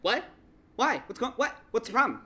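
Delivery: short, insistent questions with rising whats; rude interrupting. Retold or imitated. Performer heckling a laughing stage partner, mock rude. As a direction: needle a giggling scene partner, pausing between jabs. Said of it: "I'm pretty rude about".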